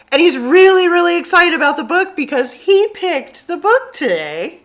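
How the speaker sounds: bright, loud and high, full of glee; eager storytime host relaying a squash's joy; excited for Bob. Acted. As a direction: sell Bob's excitement to the kids.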